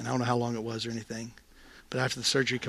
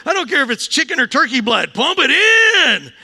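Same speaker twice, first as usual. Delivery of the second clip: insistent; 14 dB louder than usual. Retold or imitated. Insisting to the paramedic.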